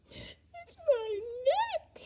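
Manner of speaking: high, slow, whimpering giraffe voice; sad giraffe. Acted. Giraffe telling George about her cold neck, high and whimpering. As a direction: sniffle it out, a sorry giraffe. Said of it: "sniffling sadly".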